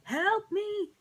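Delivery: high, loud hammed-up help; playful pretend scream for help; mock cry for help. Pretending trapped in alien slime.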